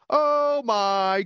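high shrieking mock-horror voice; mock horror scream. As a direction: scream like a B-movie victim with a fly on her face. Imitating screaming actress.

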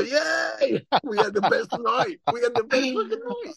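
very high-pitched mock crowd cheer; cheering crowd imitation. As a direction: play a whole delighted audience cheering. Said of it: "And they all".

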